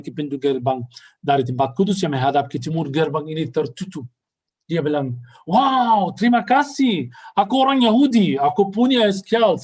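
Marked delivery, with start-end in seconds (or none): 5.5-9.6 indignant exclaim. Retold or imitated.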